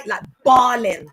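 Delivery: ordinary delivery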